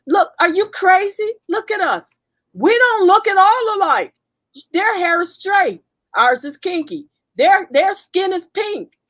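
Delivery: re-enacting an indignant comeback; indignant retort. Retold heated argument with a professor.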